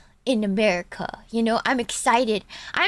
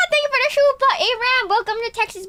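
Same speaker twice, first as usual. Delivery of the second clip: higher, louder gushing thanks; excited thanks; 11 semitones higher, 3 dB louder, faster than usual. Real, not acted. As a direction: gush your thanks.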